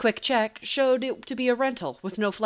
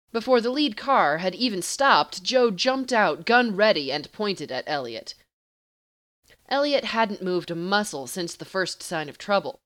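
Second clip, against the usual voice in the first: plain reading voice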